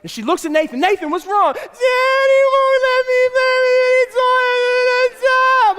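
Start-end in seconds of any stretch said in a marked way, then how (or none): 0.8-1.5 high, raised mock-mom voice; concerned mother. Wife rushes in, concerned. 1.5-5.8 very high, loud, drawn-out siren cry; wailing toddler. Acted. Wailing child tattles to mother.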